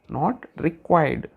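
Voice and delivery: Not required. said very slowly, stress on not. Shown as a demonstration.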